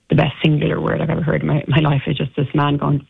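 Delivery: ordinary talk, nothing marked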